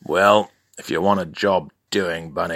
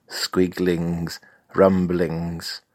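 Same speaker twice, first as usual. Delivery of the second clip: normal talking voice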